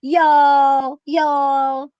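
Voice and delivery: loud, stretched Southern-New York "y'all"; Southern meets New York. Acted. Host recreating their video, Southern meets New York accent. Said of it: "Southern meets New York".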